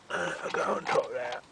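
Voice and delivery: words muffled round a torch; torch in mouth. Real, not acted. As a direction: speak with torch clenched in mouth. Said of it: "I've got torch in my mouth".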